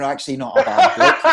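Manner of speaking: breaking into loud laughter on the punchline; laughing. Real, not acted.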